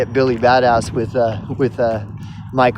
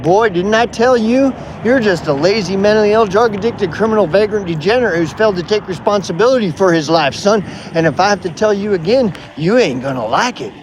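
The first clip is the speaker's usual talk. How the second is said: cranked-up Billy Badass; 6 semitones higher, faster than usual. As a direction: puff up and bark the insults at him. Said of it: "he's trying to turn up the Billy Badass volume".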